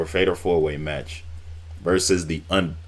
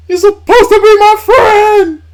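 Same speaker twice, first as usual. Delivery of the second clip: shrieked much higher and louder, slow and drawn out; screaming Batista imitation; 16 dB louder than usual. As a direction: scream the betrayal at full force. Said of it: "And then he was like".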